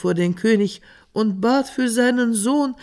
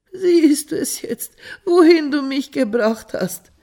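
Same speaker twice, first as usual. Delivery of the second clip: jammernder Vater; 8 semitones higher, 4 dB louder than usual. A narrator's reading. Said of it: "klagte und jammerte der alte Mann".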